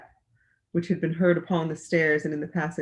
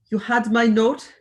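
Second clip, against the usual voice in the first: put-on slow, loud German-accented question; strong German accent; 5 semitones higher, 6 dB louder than usual. A narrator's reading. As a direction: demand it slowly in a German accent. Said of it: "deep, harsh voice in a strongly marked German accent".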